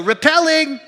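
high, loud climber's call. Retold or imitated. Climber at the top alerting whoever holds his rope, calling out. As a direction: sing it out to someone below. Calling down over shoulder from wall.

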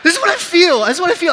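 loud, high-pitched alarm; panicked outburst. Acted. Climber stuck at the top showing his fear, panicked outburst. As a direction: blurt it high, as if trapped. Acting out panic atop the wall.

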